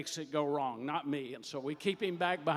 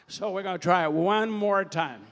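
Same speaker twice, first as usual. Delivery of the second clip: loud, raised count to rally applause; rousing count-in; 3 semitones higher, 9 dB louder than usual. Real, not acted. Host cues the audience to clap, loud rousing count. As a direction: call it out big to the whole crowd.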